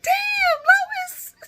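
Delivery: very high laughing yelp; laughing shout. Retold or imitated. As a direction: squeal it out, amazed. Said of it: "I was like".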